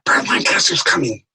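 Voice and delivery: whispered urgent warning. Retold or imitated. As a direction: whisper it so he won't hear. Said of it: "how loud do you want me to say it".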